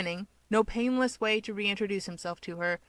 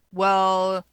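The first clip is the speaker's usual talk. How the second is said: loud drawn-out well; pointed well; 9 dB louder than usual. Acted. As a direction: lean hard on the well. Keigo's pointed interjection.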